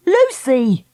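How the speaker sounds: high surprised call; crying out. Acted. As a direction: call her name in shock. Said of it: "she cried".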